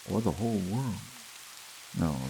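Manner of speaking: ordinary delivery, nothing marked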